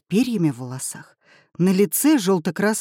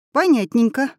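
cheerful; 7 semitones higher, 4 dB louder than usual. A narrator's reading. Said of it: "бодро сказала Аи".

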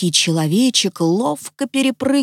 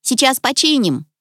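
high, squeaky gnome voice; small high-pitched reassurance; squeaky little man; 7 semitones higher than usual. A narrator's reading. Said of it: "пискнул человечек".

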